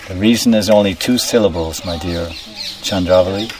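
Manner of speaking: normal talking voice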